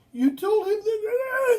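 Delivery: very high, loud, choked with laughter; laughing outburst. Retold or imitated. Staff member laughs at the nurse, barely getting the words out. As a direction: try to speak through helpless laughter. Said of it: "And they'd laugh and laugh".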